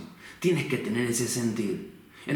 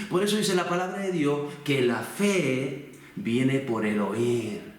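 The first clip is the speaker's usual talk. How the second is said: normal talking voice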